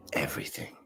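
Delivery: hushed whisper, as if sharing a sacred secret. Retold or imitated. Guru retelling his awed request to the old man, whispered. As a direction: breathe the words with mock reverence. Mystic quoting his own reply.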